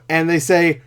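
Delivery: normal talking voice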